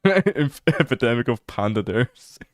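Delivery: amused at your own joke, laughing loudly; cracking up. Real, not acted. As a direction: break off into laughter, then blurt out the silly phrase. Cracks up at own influenza wordplay.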